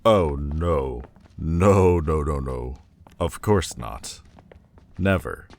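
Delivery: loud, insistent refusal, easing off; emphatic denial. Acted. Demon insists to royal that Majesty must not know, emphatic denial. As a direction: burst out to shut the idea down. Urgently denying Majesty knows.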